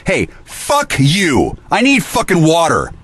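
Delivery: high, drawn-out indignant cursing; angry outburst. Real, not acted. Cursing those who shut off the water, angry outburst. Raging about water being cut off.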